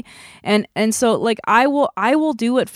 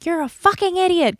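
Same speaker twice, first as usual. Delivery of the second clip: retold yell; 9 semitones higher than usual. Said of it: "he yelled at me".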